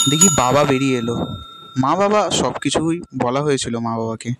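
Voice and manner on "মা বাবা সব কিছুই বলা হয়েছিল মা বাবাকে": ordinary delivery, nothing marked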